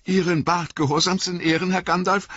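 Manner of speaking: high-pitched deferential Sam voice; high, eager-to-placate underling; Sam, servile gardener. Acted.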